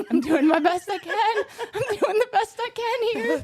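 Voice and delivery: rising, half-laughing protest; exasperated. Real, not acted. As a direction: defend yourself in mock frustration. Host flustered, defending himself.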